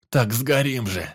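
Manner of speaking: loud, desperate cry; almost scream it at him; near-shout. A narrator's reading. Teacher nearly shouts at the forester, desperate. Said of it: "почти закричал Торопов".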